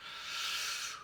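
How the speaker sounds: long exhaled sigh; deep calming breath. Real, not acted. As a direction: breathe out to stay calm. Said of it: "take a deep breath then and not swear".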